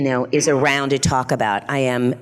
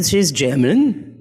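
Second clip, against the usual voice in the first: put-on German accent; slower than usual. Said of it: "That is my German accent".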